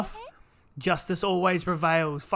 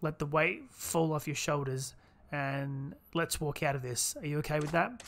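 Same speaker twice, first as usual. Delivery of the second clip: soft-voiced comforting friend; 4 dB quieter than usual. Gamer reading a friend's lines to Kanahara, lightly voiced and gentle. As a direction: read it like a soothing companion letting go. Voicing game dialogue as character.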